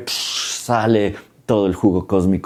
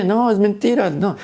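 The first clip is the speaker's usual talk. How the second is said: much higher, panicked denial; Seth denying; 12 semitones higher than usual. Retold or imitated. Seth caught out before the gods, denying much higher. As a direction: squeal out the denial.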